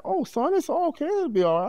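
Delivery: very high soothing mother voice; reassuring mother. Retold or imitated. Mother soothing her son on the phone, high and soothing. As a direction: comfort him like a mama.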